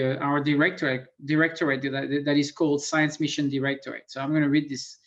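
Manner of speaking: normal talking voice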